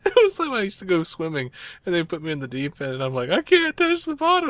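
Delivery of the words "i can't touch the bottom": high, loud imitation of a scared kid; mock childish panic. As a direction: cry out in hammed-up fright. Child panicking in the deep end.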